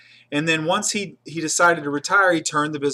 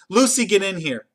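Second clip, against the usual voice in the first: angry summons; 5 semitones higher, 4 dB louder than usual. Retold or imitated. Manager summons assistant angrily.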